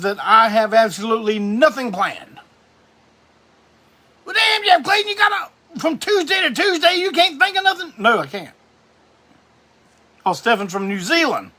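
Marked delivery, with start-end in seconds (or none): 4.2-7.9 high shouted heckling viewer voice; a viewer shouting up at the streamer in a high mocking voice; mock heckler. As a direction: jeer at yourself like a cheeky fan in the chat.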